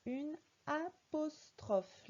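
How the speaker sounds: apostrophe stretched, syllable by syllable; a-pos-trophe said very slowly; slow syllable reading. Shown as a demonstration. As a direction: spell it out slowly with your voice. Teacher reads word for child.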